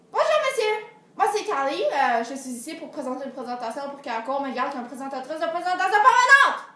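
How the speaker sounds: eager greeting. Retold or imitated. Girl introducing herself to the little scribe, eager loud greeting. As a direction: pitch yourself with bright eagerness.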